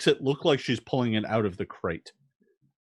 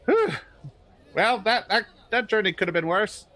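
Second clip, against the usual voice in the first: higher, laughing, tired relief; weary Varnast; 9 semitones higher than usual. Acted. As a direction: puff out an exhausted sigh of relief. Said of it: "even Varnast is like".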